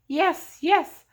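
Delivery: high, loud joyful laughing yes. A narrator's reading. Old man laughing after being set free, joyful and high. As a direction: burst out in laughing delight. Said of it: "clapped his hands as he laughed".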